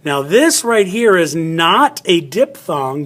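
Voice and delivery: usual speaking voice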